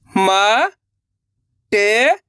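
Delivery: "मा", long pause, "टे", each held; letter by letter. Shown as a demonstration. Teacher models the method, drawn-out separate "मा", "टे". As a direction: sound out "मा" and "टे" one at a time. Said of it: "एक एक अक्षर का उच्चारण करके".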